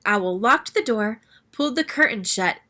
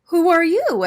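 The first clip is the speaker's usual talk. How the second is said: high, louder startled question; high startled gasp; 8 semitones higher, 7 dB louder than usual. Acted. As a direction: gasp it out in surprise. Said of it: "everyone gasped".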